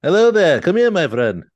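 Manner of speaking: high, loud Obi-Wan greeting; Obi-Wan impression.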